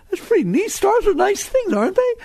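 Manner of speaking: high, delighted marvelling; giddy high-voiced delight; playful wonder.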